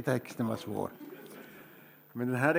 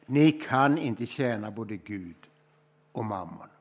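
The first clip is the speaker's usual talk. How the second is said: slow, measured first take of "Ni kan inte tjäna både Gud och mammon"; unhurried reading with the words dropping away at the end; slow scripture reading; 3 semitones lower than usual. Acted. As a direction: lay it down slowly as a quotation.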